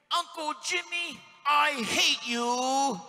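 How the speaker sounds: put-on a little boy's furious, drawn-out cry; slow, loud angry shout of a little boy; childish fury. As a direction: spit it out like an angry small nephew. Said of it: "With fury".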